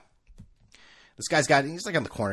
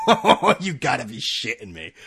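laughing through the words; can't believe it, laugh it out; disbelieving laugh while speaking; laughing disbelief. Real, not acted. Laughing at being called Sorry.

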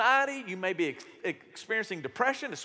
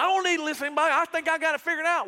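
louder, higher, cocky know-it-all voice; mock stubborn boast; 7 semitones higher, 4 dB louder, much faster than usual. Preacher mocking hard-headed people, cocky and loud. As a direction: play the proud fool who won't listen. Stubborn self-talk, mocked.

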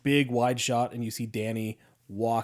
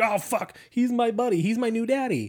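mock panicked kid; 11 semitones higher than usual. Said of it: "So Danny's like".

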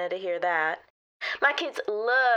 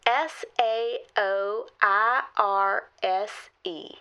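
slow letter-by-letter; 2 semitones lower, much slower than usual. Acted. Reading back spelling.

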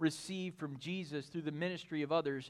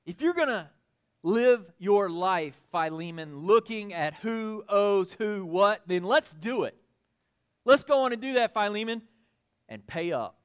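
preaching appeal; 3 semitones higher, 7 dB louder than usual. Acted. Preacher voicing Paul's demand to Philemon, urgent. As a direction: plead then drop to a firm ask.

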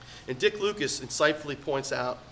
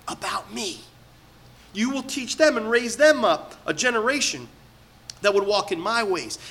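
higher, louder impassioned sermon; preaching at full force; 6 semitones higher, 6 dB louder than usual. Acted. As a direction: preach it with rising urgency.

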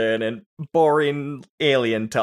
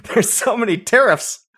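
mock pompous senator; 6 semitones higher, 4 dB louder than usual. Mocking prequel dialogue.

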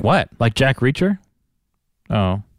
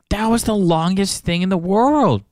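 exasperated; 7 semitones higher than usual. Retold or imitated. Reacts to endless scene.

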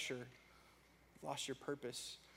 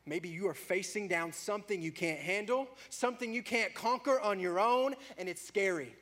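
rising, louder preaching; 6 semitones higher, 10 dB louder than usual. Acted. Preacher naming the congregation's fears, rising intensity. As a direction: build the fear into a plea.

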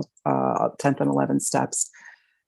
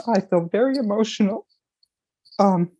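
real emotion breaking through, pitched up; emotional; 6 semitones higher than usual. Real, not acted. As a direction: voice catching and lifting as tears come. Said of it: "I'm surprised that I'm feeling so emotional".

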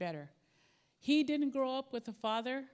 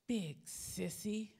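gruff dad; 6 dB quieter, much slower than usual. Acted. Dad teasing the boy who wants to sleep with him, slow and dry. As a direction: drag it out as a teasing father. Dad's punchline reply.

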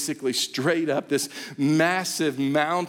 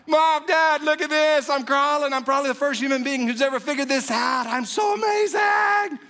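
put-on higher and louder, a crawling baby bragging; proud baby voice; 9 semitones higher, 3 dB louder than usual. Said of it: "and you're like".

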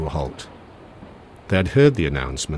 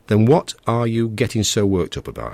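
louder, sharper impatient question; 5 semitones higher, 6 dB louder than usual. A narrator's reading. Narrator losing patience with worried Richard, impatient. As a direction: push back at him, out of patience. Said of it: "I said impatiently".

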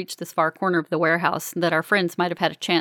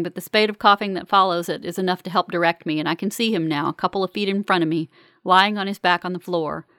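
ordinary delivery, nothing marked

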